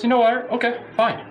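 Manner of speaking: high, fed-up bartender; exasperated bartender. Acted. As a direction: give in with a high exasperated sigh.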